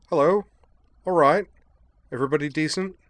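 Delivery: high, loud jolly greeting; a chipper English eccentric calling in; cheery Devlin voice. Acted. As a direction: burst in bright and hearty. Devlin pokes his head in.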